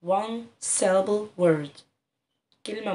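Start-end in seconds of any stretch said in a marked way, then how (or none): 0.1-1.8 One syllable word said very slowly, each word apart; drawn-out classroom explanation; slow teaching emphasis. Shown as a demonstration. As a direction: spell it out slowly for the kids. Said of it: "يبقى الكلمة دي مكونة من مقطع واحد".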